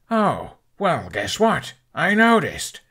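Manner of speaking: high, biting sarcasm; mock her excuse, then land it; sarcastic. Hiccup answers the excuse.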